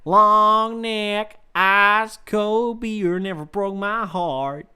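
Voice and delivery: slow, twangy country singer voice; country American accent. Retold or imitated. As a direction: drawl it like a country song. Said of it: "I'm so good at American accents".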